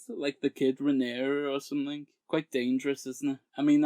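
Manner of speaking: normal talking voice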